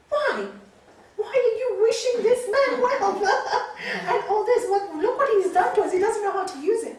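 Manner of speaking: high, loud, laughing indignation; laughing protest. Retold or imitated.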